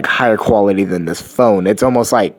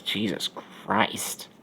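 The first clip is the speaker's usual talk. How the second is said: whispered and slow, quieter; shocked whisper; 4 semitones lower, 14 dB quieter, much slower than usual. Acted. She reacts to his story, a slow shocked whisper. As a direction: breathe it out in slow disbelief.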